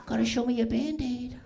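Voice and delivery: put-on high, baby-talk coo; talking to a toddler. Comic mocking adults treated like kids, high cooing. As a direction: coo at an adult like a small child. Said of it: "you're like".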